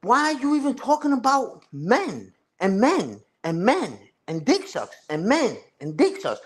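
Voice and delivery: lightly voiced mockery of his rant; a hint of a mocking echo; mocking imitation. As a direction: parrot his repeated words back in a sneering tone. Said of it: "I'm like".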